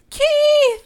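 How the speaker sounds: high, loud mock yell; calling out. Retelling her search for missing Keith, hammed-up calling out. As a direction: holler the name, drawn out and high.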